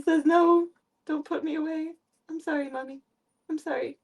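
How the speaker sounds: put-on high childlike cat voice; high small cat voice saying sorry to Mommy; voicing the cat. Voicing cat's reply.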